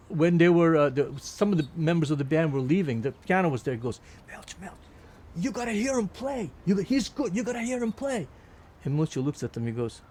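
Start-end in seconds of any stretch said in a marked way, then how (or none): 4.3-8.3 urging. Retold or imitated. Band member urging Milcho.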